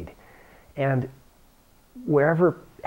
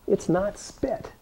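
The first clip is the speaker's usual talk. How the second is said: slow, high, soft mock denial; drawn-out high reassurance with a grin; mock insistence; 8 semitones higher, 4 dB quieter, slower than usual. Real, not acted. Teacher joking to students about moisture, slow and high.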